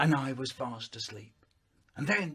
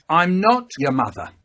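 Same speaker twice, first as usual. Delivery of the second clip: much louder flat declaration; mum's loud voice; 10 dB louder than usual. Retold or imitated. Mum coming in to announce, much louder. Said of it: "Mum comes in and says".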